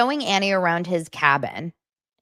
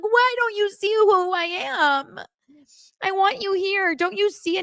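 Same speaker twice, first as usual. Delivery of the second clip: mock crying Annie. Annie pleading with Nick, mock teary and high. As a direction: sob it out, hammed up and pitched high. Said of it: "And she's like".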